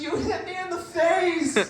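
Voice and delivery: hurt mom. Retold or imitated. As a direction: play the wounded mother, dragged out and high. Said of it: "She goes".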